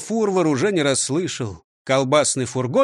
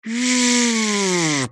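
buzzing; 5 semitones higher than usual. Acted. Buzzing sound from somewhere.

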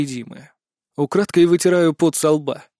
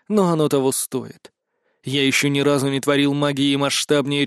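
ordinary delivery

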